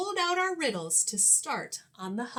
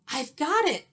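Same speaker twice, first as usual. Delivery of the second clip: excited; 6 semitones higher, 4 dB louder than usual. Acted. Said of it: "I said".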